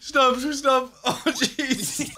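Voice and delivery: high, breathless through laughter; begging them to stop mid-laugh; crying with laughter. Real, not acted. Begging his mates to stop, crying with laughter. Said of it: "I'm actually crying".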